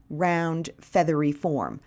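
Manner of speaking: ordinary delivery, nothing marked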